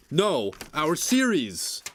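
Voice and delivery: slightly hammed-up sitcom correction; acted punchline. Peter correcting Dora about the cancellation, hammed-up. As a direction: stretch out the mock-dramatic reveal, slowly. Acting the show's scripted punchline.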